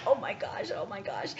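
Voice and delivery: breathless whisper, rolling back on a hill; under her breath, scared, as the car slips back; whispered panic. Retold or imitated. Scared driver whispering to herself while rolling back on a hill.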